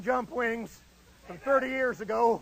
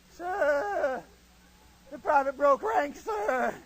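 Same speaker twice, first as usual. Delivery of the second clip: Freeman Spears; 3 semitones higher, much slower than usual. Retold or imitated. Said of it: "He talked just like that".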